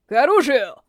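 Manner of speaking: sharp call to arms; shouting. A narrator's reading. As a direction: bark the order out loud. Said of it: "воскликнул Бурик".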